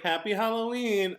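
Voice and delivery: high, laughing mock holiday greeting; imitating doorstep stranger. Friend mimics stranger greeting the kids, high and amused. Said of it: "He's like".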